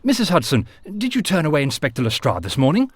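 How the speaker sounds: cried out. A narrator's reading. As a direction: call out sharply to the landlady. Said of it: "Sherlock cried".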